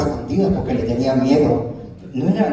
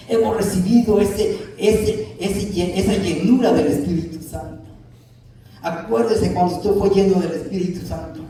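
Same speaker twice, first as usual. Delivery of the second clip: rising and falling pulpit cadence, pressing each point; a Spanish-language pastor preaching with conviction; fervent preaching; 5 semitones higher than usual. Acted. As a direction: preach it out to the congregation like a revival. Preaching to the congregation.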